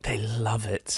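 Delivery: put-on soft London arts-journalist accent; softly joining in the London British mockery. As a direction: echo it in a hushed London voice. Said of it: "London journalists coming up".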